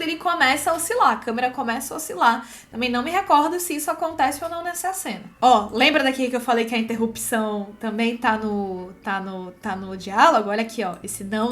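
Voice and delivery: normal talking voice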